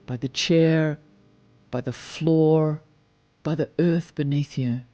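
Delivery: ordinary talk, nothing marked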